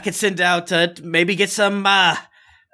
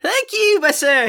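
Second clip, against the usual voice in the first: much higher, squeaky little voice; high squeaky character voice; 12 semitones higher, slower than usual. Acted. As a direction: squeak it like a nervous little man. Nightbread Johnny thanks.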